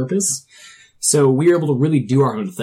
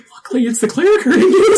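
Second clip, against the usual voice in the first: laughing; 6 dB louder than usual. Retold or imitated. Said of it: "And I was like".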